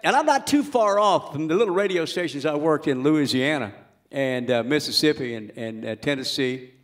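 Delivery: ordinary delivery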